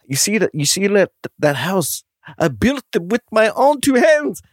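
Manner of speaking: put-on high, Transylvanian-sounding Scottish accent; botched Scottish accent. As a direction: tell it as a proud Scotsman, accent badly off. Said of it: "That did sound kinda Transylvanian".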